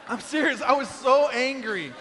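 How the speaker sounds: normal talking voice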